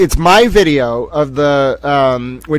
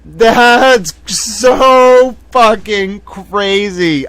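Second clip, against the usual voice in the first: high, hugely drawn-out amazement; slow, raised-pitch incredulity; stunned disbelief; 5 semitones higher, 3 dB louder, much slower than usual. Real, not acted. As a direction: stretch every word out, astounded. Stunned by the whispering.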